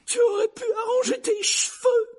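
very high, loud shriek; shrill scolding from an aunt; piercing voice. A narrator's reading. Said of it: "la voix perçante de la tante Pétunia".

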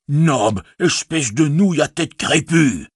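high-pitched scolding of a servant; exclaimed scolding. A narrator's reading. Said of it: "s'écria-t-il". (réprimande aiguë, en s'exclamant)

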